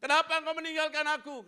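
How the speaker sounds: loud, high anguished cry; Jesus on the cross, forsaken, anguished. Acted. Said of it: "dia teriak".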